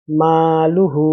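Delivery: মালুহু read slowly, মা held one alif long, লু হু quick; slow drawn-out reading. Shown as a demonstration. Teacher models মালুহু for students, slow and stretched. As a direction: draw out the মা in মালুহু, keep লু and হু short. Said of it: "লাম পেশ লুটা হলো হরকত, এর জন্য তাড়াতাড়ি পড়তে হবে".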